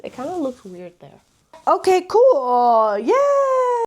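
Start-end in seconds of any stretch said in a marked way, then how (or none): 1.7-3.8 forced cheer. Real, not acted. Forced satisfaction at shelf.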